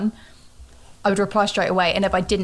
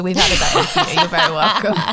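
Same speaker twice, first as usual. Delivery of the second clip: fast, louder, laughing through it; laughing joke; 5 dB louder, faster than usual. Real, not acted. Joking aside, laughing.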